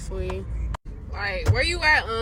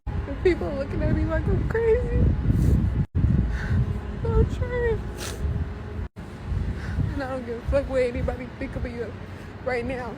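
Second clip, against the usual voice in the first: tearful outburst; 4 semitones higher, 4 dB louder than usual. Real, not acted. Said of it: "walking and crying".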